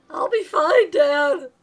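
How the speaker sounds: put-on high, loud boyish protest; confident kid. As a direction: insist like a small boy. Son reassures worried father.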